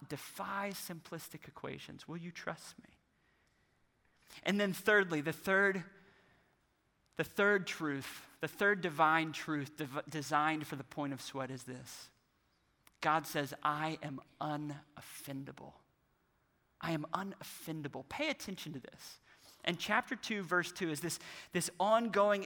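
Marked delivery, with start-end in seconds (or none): 0.1-2.9 hushed, much softer voice; quiet, intimate appeal as God; gentle divine appeal. Acted. God's quiet appeal, acted. 13.6-18.1 soft, deliberate voice of God; hushed, measured pronouncement; quiet divine declaration. Acted. God's declaration, quietly acted.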